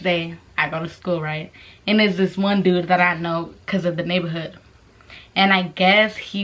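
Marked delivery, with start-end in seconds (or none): none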